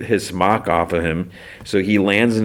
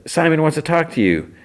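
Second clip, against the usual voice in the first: high-pitched imitated call; mock yell upward; 10 semitones higher than usual. Fiona yells up to Huntress in the tree, mock yell. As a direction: pretend to call up to someone, voice lifted high. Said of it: "And she yells up".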